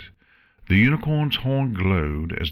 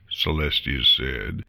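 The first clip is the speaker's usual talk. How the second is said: usual speaking voice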